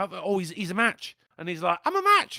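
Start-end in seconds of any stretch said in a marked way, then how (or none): 1.9-2.4 high, pleased squeak; high squeaky voice. Retold or imitated. Husband squeaking that he is a donor match. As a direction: squeal it out happily. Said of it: "I don't know why I did that way".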